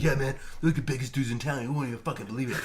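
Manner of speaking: a hint of a bro voice, laughing; lightly voiced bragging bar buddy; imitating a bragging guy. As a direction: brag like it's the biggest news. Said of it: "he was like".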